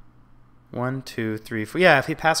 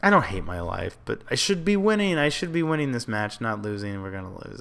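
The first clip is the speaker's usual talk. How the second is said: ordinary talk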